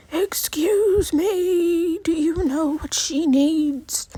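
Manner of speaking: high, slow, overly careful voice of a stranger asking someone else; condescending stranger. Retold or imitated. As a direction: mimic a patronising waiter talking past her. Strangers ask companion about blind woman.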